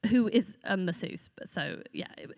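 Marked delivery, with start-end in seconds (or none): 1.4-2.3 whisper. Real, not acted. Aside whispered during anecdote.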